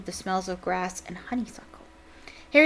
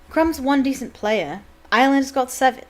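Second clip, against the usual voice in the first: curt; 5 semitones higher, 7 dB louder than usual. Acted. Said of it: "said Charlie shortly".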